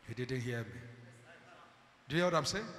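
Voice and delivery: ordinary talk, nothing marked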